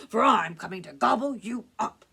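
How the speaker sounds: loud, menacing troll threat. Acted. Troll threatens to eat the goat, loud and menacing. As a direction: boom the threat at a little goat. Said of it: "said the troll".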